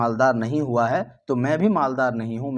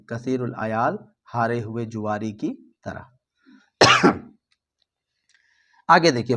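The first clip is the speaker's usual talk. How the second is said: usual speaking voice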